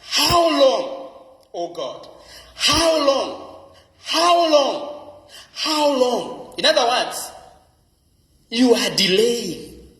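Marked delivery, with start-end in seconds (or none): 0.0-6.5 high, aching cry of how long, over and over; a frustrated man begging how long, high and aching; anguished repeated plea. Acted. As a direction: plead with God, each how long pitched up. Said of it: "repeated four times for emphasis".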